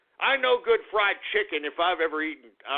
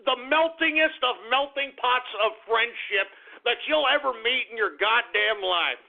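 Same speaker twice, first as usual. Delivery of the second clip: normal talking voice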